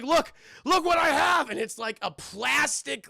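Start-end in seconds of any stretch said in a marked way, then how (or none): 0.0-1.5 high, loud and eager; acted-out gleeful shout; excited showing-off. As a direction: imitate a thrilled dad showing off treasure. Dad shows off his prize.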